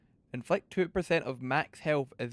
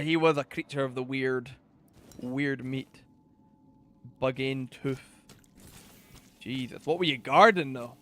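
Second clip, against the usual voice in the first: ordinary delivery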